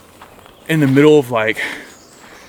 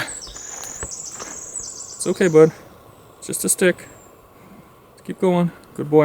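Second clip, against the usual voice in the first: higher, soft coaxing pet talk; soothing dog voice; 6 semitones higher, 4 dB quieter than usual. Retold or imitated. Hiker soothing his dog about a stick, high and soft. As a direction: reassure a nervous dog gently.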